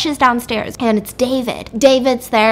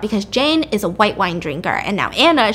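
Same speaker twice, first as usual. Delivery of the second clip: ordinary delivery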